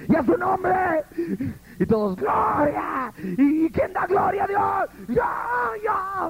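high mocking shout of a hyped worship leader; exaggerated preacher whipping up the crowd. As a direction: ham up the frenzied leader calling out.

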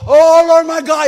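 high, loud, slow cry to God; panicked cry. Retold or imitated. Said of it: "y gritó".

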